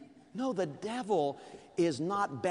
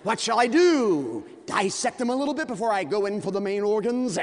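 gloating giant; 5 semitones higher, 7 dB louder than usual. Acted. Giant gloating over David.

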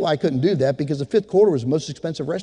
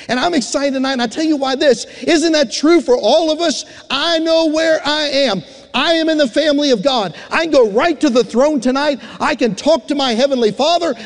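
high, loud preaching build-up; full-force preaching; 9 semitones higher, 5 dB louder than usual. Acted. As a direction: preach it out to the whole congregation.

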